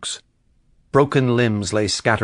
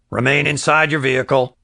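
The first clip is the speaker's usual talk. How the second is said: sheriff on loudspeaker; 4 semitones higher than usual. Acted. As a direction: bark it like a cop over a roof speaker. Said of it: "his voice rasped through the loudspeaker".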